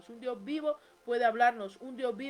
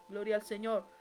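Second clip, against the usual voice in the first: usual speaking voice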